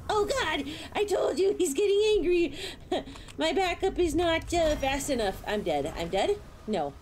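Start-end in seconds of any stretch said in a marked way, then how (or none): 0.1-5.1 higher, louder frantic cries; panicked shout at the monster; panicked yell. Real, not acted. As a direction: shriek as it charges.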